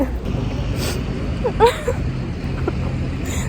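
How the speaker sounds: high breathless laugh-sob; laughing through tears; real high sobbing laugh; crying laugh. Real, not acted. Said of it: "I don't know why the fuck I'm crying".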